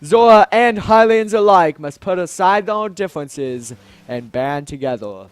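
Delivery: stately speech with loud, punched openings; rally them like a monarch; grand Zora king, rallying. Acted. King urging both peoples to unite, grand and earnest.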